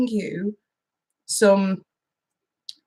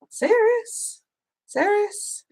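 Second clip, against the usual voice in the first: "Serious?" much higher, mock-shocked twice; surprised; 11 semitones higher, 5 dB quieter, much slower than usual. Real, not acted. Host to returning viewer, high mock surprise. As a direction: pitch way up in playful disbelief. Greeting a long-absent viewer.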